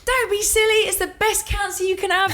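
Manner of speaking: playful impression of an unbothered father. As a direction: put on a jolly Mary Poppins chimney-sweep voice for dad.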